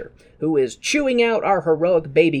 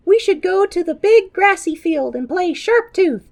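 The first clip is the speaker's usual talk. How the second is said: put-on high bright kid's voice, much higher; 5 dB louder than usual. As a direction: play an eager little dinosaur kid. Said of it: "the kids ... are like".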